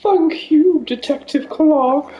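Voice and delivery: high posh lady voice; slow posh widow. Pretend. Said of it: "another posh voice".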